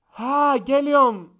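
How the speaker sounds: high, very loud mock call; exaggerated shouted phone reply; imitated phone bellowing. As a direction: holler it so the whole bus hears. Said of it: "hala aynı ses tonunda bağırıyor".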